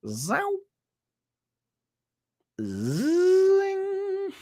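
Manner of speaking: rising high zing sound effect; cartoon zip noises. Streamer drawing chart lines with rising zippy noises. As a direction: swoop sharply upward in pitch.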